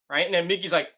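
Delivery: ordinary talk, nothing marked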